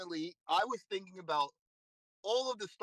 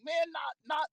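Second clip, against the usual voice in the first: frustrated curse; 10 semitones higher, slower than usual. Retold or imitated. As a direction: yell at yourself for losing round one. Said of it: "I'm like".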